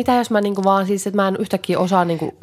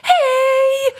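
a bright, much higher call of hei; cheerful shout; 4 dB louder than usual. Retold or imitated. Lee plunges into the hot tub with a cheerful hei. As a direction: yell hei! happily as you splash in. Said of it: "iloinen huudahdus".

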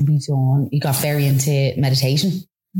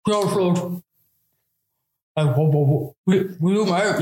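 garbled words round a mouthful of toffee; imitated mouthful; 2 semitones higher than usual. Boyfriend proposing on one knee, mouth full of toffee. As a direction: mimic trying to talk with a full mouth. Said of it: "His mouth is full".

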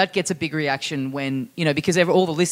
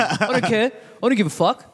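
dismissive Aussie abroad, pitched up a little; hammed-up ignorant tourist who couldn't care less; obnoxious Australian tourist; 4 semitones higher than usual. Traveller invoking his right to be rude overseas, mock-dismissive. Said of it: "being a piece of shit Australian overseas".

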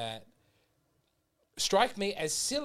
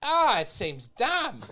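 high, loud, drawn-out groan of complaint; mock dismay; 10 semitones higher, 7 dB louder, much slower than usual. Reacting to silly-sounding English liturgy, mock dismay. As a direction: stretch it out in exaggerated dismay.

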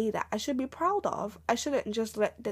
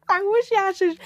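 emotional burst; 10 semitones higher, 8 dB louder than usual. Real, not acted.

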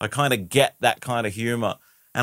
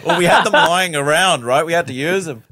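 laughing through the words, louder; 5 semitones higher, 6 dB louder than usual. Real, not acted. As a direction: chuckle while explaining. Laughing about the wigs.

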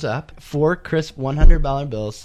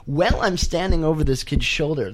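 normal talking voice

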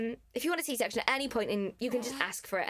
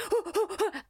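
sharp gasp; 9 semitones higher than usual. Retold or imitated. Mid-contraction, waking up.

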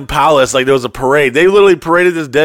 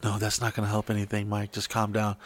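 much softer, lower, calming; calm soothing voice; 4 semitones lower, 15 dB quieter than usual. Retold or imitated. Girl calming Mike down softly before he punches. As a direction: hush him down gently.

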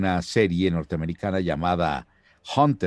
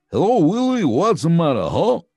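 high, loud alien puppet voice; Alf voice in English; 10 semitones higher, 6 dB louder than usual. Retold or imitated. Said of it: "Así hablaba". (voz alta y fuerte de Alf en inglés)

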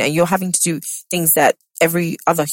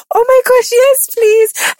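much higher and louder, thrilled relief; relieved excitement; 5 dB louder, slower than usual. Retold or imitated. Pregnant host retelling accepting help on the flight, thrilled. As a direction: gush with grateful relief. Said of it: "I was like".

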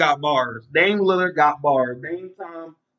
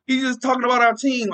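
higher whiny mock voice of fans; mocking offended fans; 8 semitones higher than usual. Podcaster mimics offended fans complaining, higher mock whine. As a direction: mimic touchy fans whining. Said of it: "try to say".

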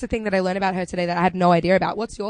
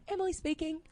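phone voice; 7 semitones higher, 13 dB quieter than usual. Retold or imitated. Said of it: "I go a bit higher, a little bit nicer".

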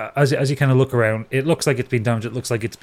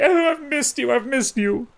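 very high, clingy cooing; gushing octopus voice. Acted. Orapan hugging Capri after a long absence, very high and gushing. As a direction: hug them and squeal it up high. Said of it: "wraps all eight arms and legs around you".

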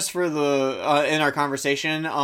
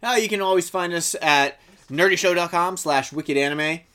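usual speaking voice

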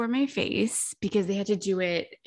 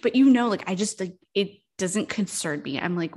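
usual speaking voice

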